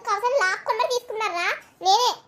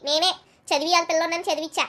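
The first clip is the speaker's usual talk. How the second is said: ordinary talk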